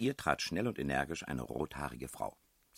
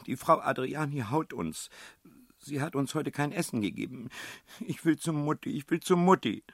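put-on high, loud wailing child voice; sobbing child; 13 semitones higher, 9 dB louder than usual. A narrator's reading. Child complains of cruel headmistress and wants mother, high tearful wail. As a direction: act a distraught child pleading for mother. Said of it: "Das Kind schluchzte".

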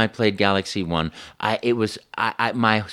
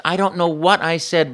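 normal talking voice

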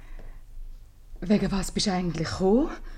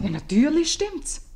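eager agreement; 11 semitones higher, 6 dB louder than usual. Acted.

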